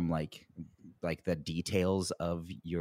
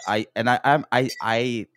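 ordinary talk

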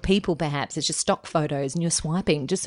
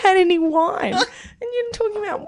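laughing; 11 semitones higher than usual. Real, not acted. Laughing teasing.

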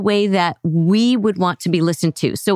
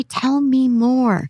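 slow gentle invitation; 3 semitones higher, much slower than usual. Shown as a demonstration. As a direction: draw them out gently, slowly. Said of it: "You say".

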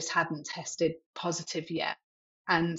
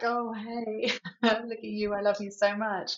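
higher-pitched, lovestruck cooing with a laugh; swoony; 5 semitones higher than usual. Acted. Mother acting out doting on her toddler, swoony. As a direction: gush over your adored little one. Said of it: "like, being spoony".

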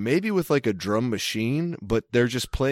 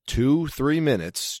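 ordinary talk, nothing marked

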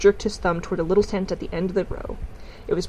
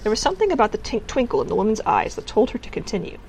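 ordinary delivery, nothing marked

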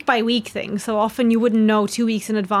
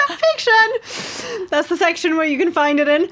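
high, laughing aside; amused, high-voiced quip; 8 semitones higher, 3 dB louder than usual. Real, not acted. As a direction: say it through giggles, pitched way up. Joking about the book's genre.

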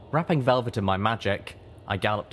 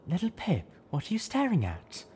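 high character voice; 11 semitones higher than usual. A narrator's reading. Companion asks Little Pip.

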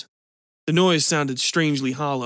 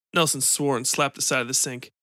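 ordinary delivery, nothing marked

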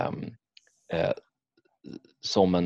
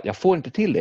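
exasperated; 8 semitones higher than usual. Retold or imitated. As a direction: blurt it out, frustrated at the boss. Failing the final boss.

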